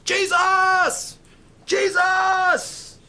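high, loud holler for attention; desperate yell. Acted. Blind Bartimaeus calling out to Jesus, desperate yell. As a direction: yell the name at full force. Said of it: "yelled that name".

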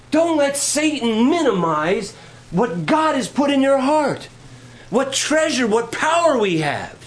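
rally the congregation against Satan; full-force preaching. Acted. Preacher climaxes.

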